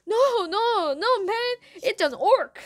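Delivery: high, loud, excited taunt; jeering excitement. A narrator's reading. Kid topping his friends' insults, loud jeer. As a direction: shout down the others' guesses.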